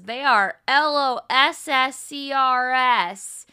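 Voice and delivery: loud, slow spelled-out taunt; imitated taunt. Fiona confronting him for talking to other people, taunting. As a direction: spell it out loudly, letter by letter. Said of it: "and she says".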